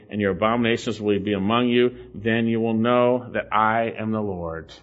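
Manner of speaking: low, measured Bible reading; scripture reading. Acted. Preacher reads God's judgment to the congregation, low measured reading. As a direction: read the passage solemnly and evenly, low.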